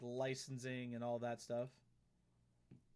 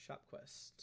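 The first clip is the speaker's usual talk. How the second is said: whispered to herself; 9 dB quieter than usual. Real, not acted. Repeats name to herself, whispered.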